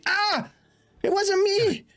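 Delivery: very high, frightened, stammering voice; pleading Hermie. Acted. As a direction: squeal out halting excuses after being hit. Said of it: "Hermie presumably".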